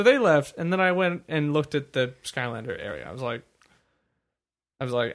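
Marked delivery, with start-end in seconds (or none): none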